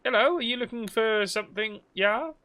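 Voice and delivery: playful fake German accent. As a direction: ham up a German shopkeeper offering help. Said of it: "is this, like, German?".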